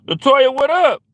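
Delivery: loud, high call-out greeting. Real, not acted. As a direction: call across the room to Latoya. Calling out to chat member.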